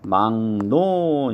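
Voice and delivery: 막노 said slowly as 망노, ㄱ turned to ng; teacher's drawn-out demo of 막노 as [망노]; nasalized pronunciation. Shown as a demonstration. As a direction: stretch 막노 into "mang-no". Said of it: "발음을 할 땐".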